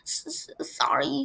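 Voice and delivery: stammering through sobs; sobbing stammer. A narrator's reading. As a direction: choke out an apology, stuttering. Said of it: "sobbed Hagrid".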